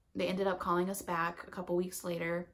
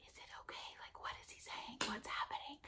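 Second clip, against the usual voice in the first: breathy hushed whisper; 3 semitones higher, 14 dB quieter, faster than usual. Retold or imitated. Boyfriend whispering anxiously during the doctor's call. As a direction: whisper urgently so the doctor can't hear. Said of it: "Like, whispering".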